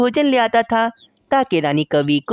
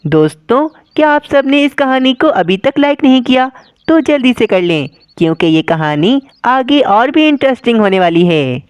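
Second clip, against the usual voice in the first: loud viewer appeal; 2 semitones higher, 5 dB louder than usual. Acted.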